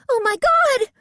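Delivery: high, loud startled shriek; a panicked shriek at a figure in the dark; frightened scream. A narrator's reading. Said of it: "Bess screamed".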